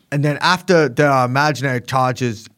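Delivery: bizarre English-American accent; put-on English-American hybrid accent; Andrew Tate. Shown as a demonstration. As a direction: attempt a muddled half-English, half-American accent. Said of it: "impression of Andrew Tate".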